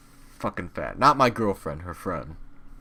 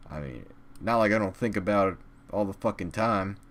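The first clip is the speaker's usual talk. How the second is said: ordinary talk, nothing marked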